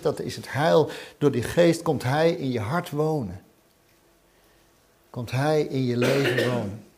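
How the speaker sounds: ordinary delivery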